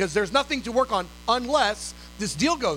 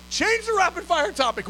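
excited announcement; 8 semitones higher than usual. Retold or imitated. Calling section leader with good news.

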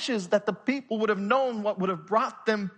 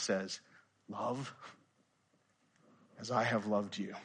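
softer, hushed preaching to the room; soft, searching sermon close; quiet closing appeal; 7 semitones lower, 4 dB quieter than usual. Acted. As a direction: bring it down and ask them gently.

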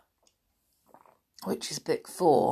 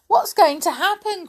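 high, raised storybook cry; alarmed cry; 13 semitones higher, 8 dB louder than usual. A narrator's reading. Joe cries out as the animals rush about, alarmed. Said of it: "cried Joe".

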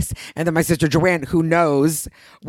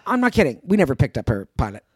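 ordinary talk, nothing marked

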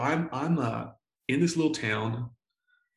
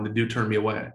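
ordinary talk, nothing marked

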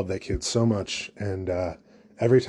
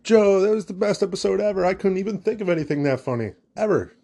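high, over-the-top fawning fan voice; breathless mock praise, voice pitched up; Steve Martin gushing; 8 semitones higher than usual. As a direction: gush like a starstruck fan, way up high. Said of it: "and he's like".

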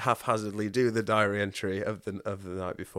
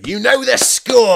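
loud, much higher, slow boisterous shout; Robert's boisterous call; 14 semitones higher, 16 dB louder, slower than usual. Retold or imitated. Robert answering an affirmation, booming and boisterous. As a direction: bellow it like a rowdy cheerleader. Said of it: "and he'd go".

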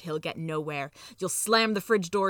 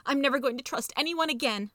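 high, wounded mouse lament; high-pitched self-pity; groaning; 7 semitones higher than usual. A narrator's reading. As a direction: groan with betrayal in a raised voice. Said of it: "he'll groan".

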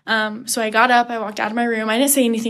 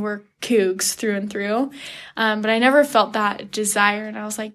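ordinary talk, nothing marked

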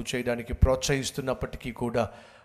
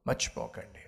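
usual speaking voice

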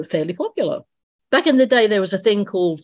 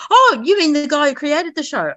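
surprised; 8 semitones higher, 3 dB louder than usual. Retold or imitated. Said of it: "I went".

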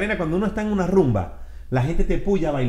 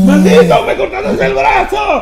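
high, hammed-up shout, laughing; mock angry yell; 10 dB louder, slower than usual. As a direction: yell it like a cheated victim. Acting the prank victim, yelling.

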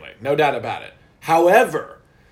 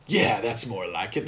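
low, slow smug mimicry; lightly mocked smug voice; mock smug line; 10 semitones lower, 8 dB quieter than usual. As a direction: drag it out, self-satisfied. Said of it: "Even though it's not factually correct".

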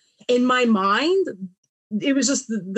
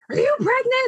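very high, alarmed mock mom voice; imitating a mother jumping to conclusions; mom's startled guess. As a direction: squeak it out like a shocked mother.

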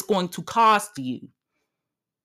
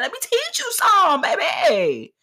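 sassy high; 11 semitones higher than usual. Real, not acted. Sassy teaching.

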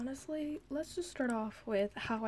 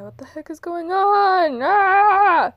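much louder, higher panicked cry; frantic shout; 10 semitones higher, 16 dB louder, slower than usual. Real, not acted. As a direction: yell in exasperated panic. Phone buzzing, computer turned on.

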